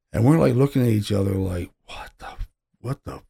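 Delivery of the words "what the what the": breathy whisper, much softer; hushed what the; whispered disbelief. Retold or imitated. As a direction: whisper it in shock to your partner. Hushed reaction to shaking boxes.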